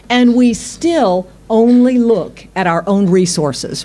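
ordinary delivery

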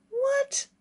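very high, incredulous yelp; indignant surprise. Acted. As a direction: shoot the question right up in pitch. Youth outraged at dead man's threat.

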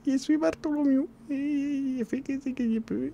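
high, slow, soft character voice; Bartholomew voice. Acted. Streamer voicing Bartholomew to the chat, high and slow. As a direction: introduce yourself as a timid odd figure, high and slow. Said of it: "Il parle un peu comme ça".

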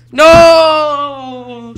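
loud, high drawn-out no; anguished shout. Real, not acted. Gamer getting killed suddenly, anguished shout. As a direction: cry out as you die. Dying in game.

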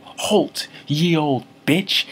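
hammed-up Old English command, drawn out; mock yell. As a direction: yell it like a mock-medieval challenge. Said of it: "I just yell".

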